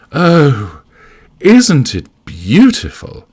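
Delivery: high, slow gush; gushing admiration. Acted. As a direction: coo over it, drawn out and high. Jane admiring the hotel reception.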